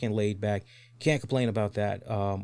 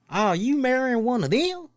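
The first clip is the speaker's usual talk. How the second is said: mock cake guy; 13 semitones higher, 4 dB louder than usual. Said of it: "looks at Tams like".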